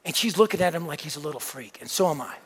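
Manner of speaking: ordinary delivery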